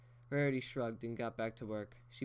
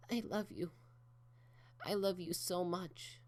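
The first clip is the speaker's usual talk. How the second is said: tearful; 9 semitones higher than usual. Acted. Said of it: "Rarity was crying right along with her".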